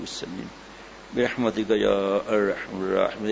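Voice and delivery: slow, low, hushed recitation of the dua; slow devout Arabic supplication to close the sermon; closing prayer. Acted. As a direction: intone the prayer slowly and softly, head bowed.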